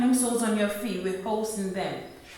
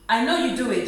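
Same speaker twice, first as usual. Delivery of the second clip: ordinary talk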